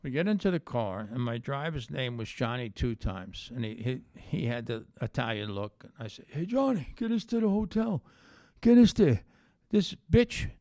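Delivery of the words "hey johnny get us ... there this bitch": higher, loud tough-guy order; mob boss order. Retold or imitated. Ordering driver Johnny to the hotel, a hammed-up mob boss.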